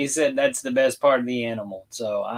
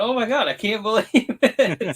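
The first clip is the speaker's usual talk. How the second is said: amazed; 9 semitones higher, 6 dB louder than usual. Retold or imitated. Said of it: "and I'm going".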